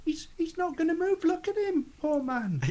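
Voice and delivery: very high-pitched pleading; pitying character voice. Acted. Player voices character pleading for a man.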